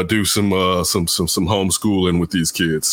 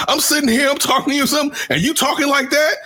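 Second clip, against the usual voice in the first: high, raised scolding teacher voice; mock angry teacher; faster than usual. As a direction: tell the kid off with outrage. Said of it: "He was like".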